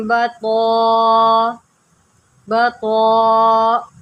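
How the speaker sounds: slow, drawn-out tajweed chanting; measured melodic Quranic recitation. Shown as a demonstration. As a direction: recite slowly, stretching each vowel. Recitation continues.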